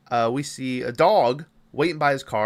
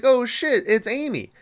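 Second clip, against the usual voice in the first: higher-pitched retold surprise; surprised recognition; 10 semitones higher than usual. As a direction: replay your reaction to spotting a familiar character. Recalls own reaction on recognizing Amy.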